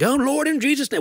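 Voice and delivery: high mock praying cry; mock fervent prayer. Son parodying showy prayer over him, mock fervent. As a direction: pitch it way up, hammed-up fervent praying. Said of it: "not like".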